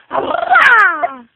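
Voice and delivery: very high, loud playful cry; loud peekaboo shout. Acted. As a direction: burst out at the baby. Peekaboo game with baby.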